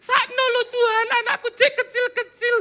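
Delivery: high-pitched, hammed-up tearful begging; mock wailing plea. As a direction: plead with God in a put-on whine. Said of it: "Musa gak teriak-teriak sama Tuhan".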